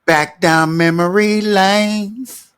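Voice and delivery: mock dramatic. Real, not acted. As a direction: stretch it like a sad movie line. Dramatic aside.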